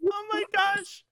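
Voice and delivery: high, helpless laughter through the words; laughing. Real, not acted. Game master reacts to Goku drinking the bottle, laughing.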